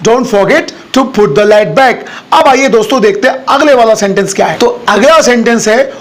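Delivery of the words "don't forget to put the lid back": drawn out, very slow; slow teaching pace. Shown as a demonstration. Teacher showing learners another way to say it, slow. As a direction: lay out each word for learners. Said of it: "किसी और अंदाज में बोल सकते हैं".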